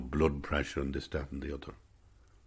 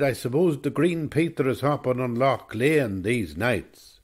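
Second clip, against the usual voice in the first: put-on higher-pitched, lilting Cavan accent; 7 semitones higher, 4 dB louder than usual. Old man chatting to a stranger about the lake, imitated Cavan accent. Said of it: "in a kind of a Cavan accent".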